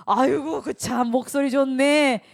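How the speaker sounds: put-on loud, slow, delighted exclaim over the crying baby; 기뻐서 외침. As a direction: cry out with joy at the baby's voice. 아이 울음소리에 기뻐하는 어머니. (아기 울음에 기뻐 크게 외치는 목소리)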